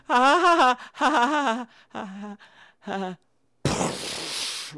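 hammed-up laugh fading to breath; two scared men laughing nervously in the dark; mock laugh. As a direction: laugh it off, then fade out.

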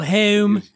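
"home" as "heh-oom"; Philly O. Shown as a demonstration. Host demonstrates the big tell, Philly O in home. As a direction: front and stretch the O in home. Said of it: "the O's are the big tell".

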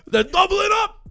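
loud, high mock-Scottish shout; Scottish accent. Co-host joking about drunk town-naming, shouted Scottish accent. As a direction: holler it like a wildly excited Scot. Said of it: "That was Scottish".

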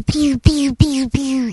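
very high, loud pew pew blasts; cartoon laser zaps, squeaky and loud; laser sound effects. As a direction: mimic a toy ray gun firing. Imitating robot's laser gun.

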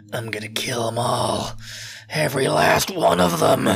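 hammed-up vow of revenge, drawn out; vengeful Eren. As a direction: start whispered, end in forceful menace. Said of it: "Who, who says that?".

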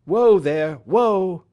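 loud, high shout to the horse; calling to horse. Acted. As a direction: haul the horse to a stop with a call.

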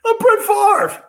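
high, loud self-important whine; hammed-up whiny quarterback; mock Favre whine. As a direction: pout out the name. Mocking Favre again.

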